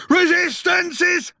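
high, slow full-lunged bellow. A narrator's reading. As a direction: fill the lungs and roar it. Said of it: "He filled his lungs and bellowed".

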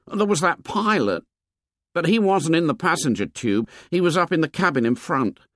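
put-on high-pitched, earnest boy's voice; high boyish character voice; fat schoolboy. A narrator's reading. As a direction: play an anxious plump schoolboy, pitched high.

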